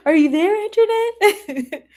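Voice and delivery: high, drawn-out sing-song call. Acted. Host calling out to viewer Antoinette, sing-song. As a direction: call out playfully, as if through a house.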